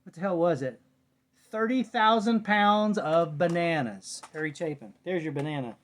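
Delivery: ordinary talk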